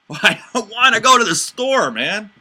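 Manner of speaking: loud, high mocking "I don't wanna go"; sarcastic refusal. Shown as a demonstration. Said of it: "I meant two completely different things".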